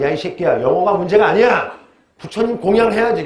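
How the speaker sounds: 끝에서 높이 치솟는 과장된 호통; 학생을 꾸짖듯 장난스럽게 외쳐라; 호통치는 강사 흉내. Acted. 불교식 학원 강사 흉내. (학생에게 장난스럽게 호통치는 강사 말투)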